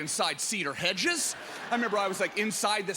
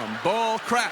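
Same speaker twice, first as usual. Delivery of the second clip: mock indignant; 3 semitones higher, 5 dB louder, slower than usual. Repeated mock call-out.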